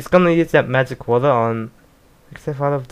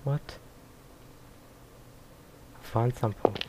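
much softer, subdued dialogue; hushed character voices; 9 dB quieter than usual. Acted. Aya wakes and speaks to father, soft and subdued. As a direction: murmur the lines like a sad reunion. Quiet reading of game dialogue.

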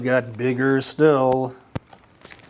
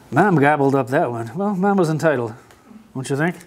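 ordinary delivery, nothing marked

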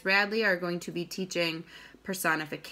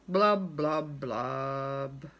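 low, sinking blubs, last one drawn out; gloomy fish; 6 semitones lower, much slower than usual. Acted. Pout-pout fish spreads dreary wearies, gloomy low refrain. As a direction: drop down deep and glum, stretching the final word.

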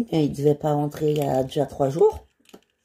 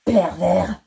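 loud, higher indignant outburst; outraged shout; 4 semitones higher, 4 dB louder, slower than usual. Acted. Woman rejecting a pervert, loud outraged yell. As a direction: snap back in shocked disgust.

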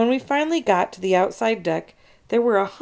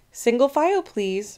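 Miss Mackle calling out an order; teacher's order; 5 semitones higher than usual. A narrator's reading. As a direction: herd the class into line. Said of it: "Miss Mackle ordered".

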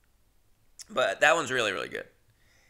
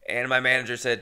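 ordinary delivery

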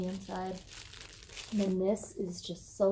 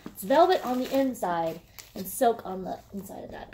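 normal talking voice

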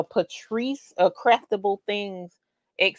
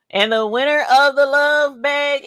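slow, higher, louder build to a whoop; winner announcement; 9 semitones higher, 5 dB louder, much slower than usual. Real, not acted. Host revealing the love bag winner, slow build to a whoop. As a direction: stretch the reveal, then cheer.

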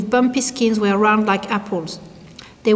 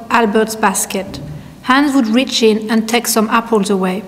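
ordinary delivery, nothing marked

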